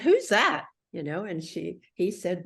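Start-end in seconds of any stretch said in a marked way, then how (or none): 0.0-0.7 high, loud acted exclamation; struck with interest. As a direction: blurt it out as if suddenly smitten. Acting out his reaction to photo.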